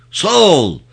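loud, high troop shout; roared response. A narrator's reading. Group answering commander's salute, roaring. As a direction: bellow it back like soldiers on parade. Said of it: "tek bir ağızdan gürledi grup".

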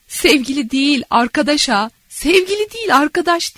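cracking up mid-phrase; laughing. Acted. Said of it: "ardı ardına yuvarlanıyor kahkahalar".

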